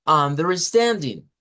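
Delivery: Understanding a bit slow, stand lifted; stress on stand. Shown as a demonstration. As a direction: up on stand then down on ding. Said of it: "Y eso es hablar con tono".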